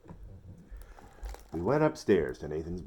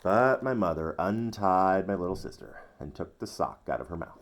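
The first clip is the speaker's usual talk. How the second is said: usual speaking voice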